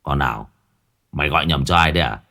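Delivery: low, irritated grumble, then fast; annoyed drunk ex-husband grumbling down the phone. A narrator's reading. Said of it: "Hắn lại cằn nhằn".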